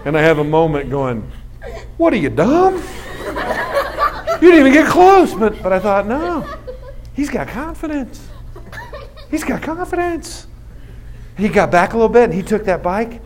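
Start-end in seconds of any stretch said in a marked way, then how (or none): 2.0-5.3 high, stretched-out disbelief; mock-exasperated high voice; acted incredulity. Acts incredulous dad's thought. 6.1-10.5 high, soft, drawn-out awe; hushed high-pitched admiration; acted marvelling. Acts dad reconsidering, in awe.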